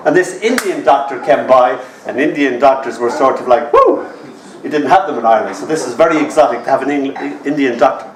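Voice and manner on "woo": excited woo. Retold or imitated. Exotic Indian doctors.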